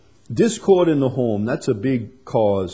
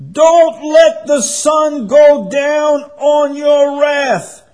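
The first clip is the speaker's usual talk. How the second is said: slow, high, shouted proclamation; shouted verse; 10 dB louder, much slower than usual. Acted. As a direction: cry the verse out to the whole church. Preacher quotes the verse again.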